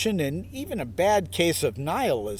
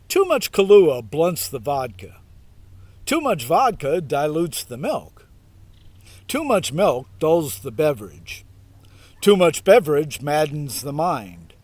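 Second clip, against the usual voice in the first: mock solemn reading; 4 dB louder than usual. Host reading the Dudeist version, mock solemn and louder. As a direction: parody scripture read, louder, deadpan. Reads dudist parody version.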